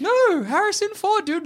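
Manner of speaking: high insisting. Retold or imitated. Playing the insistent party guy.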